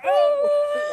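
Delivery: high startled oh; startled yelp. Retold or imitated. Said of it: "and she's like".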